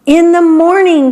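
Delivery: high, loud group cry; excited cry. A narrator's reading. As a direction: cry out together with joy. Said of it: "cried all the other beetles".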